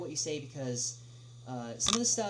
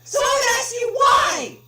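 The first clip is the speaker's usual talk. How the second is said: slow angry shout; 18 dB louder, slower than usual. Acted. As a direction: shout each word slowly and much louder. Angry caller.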